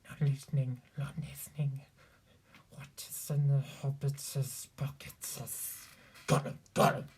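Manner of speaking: guttural creature muttering into whisper; Gollum. Shown as a demonstration. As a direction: rasp it from the back of the throat. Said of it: "Gollum is a little lower and a little more guttural".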